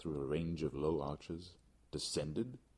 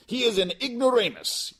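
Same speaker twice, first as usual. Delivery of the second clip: much higher and louder, cutting in; scornful outburst; 14 dB louder than usual. Acted. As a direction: scoff at the rival. Fortunato interrupts scornfully.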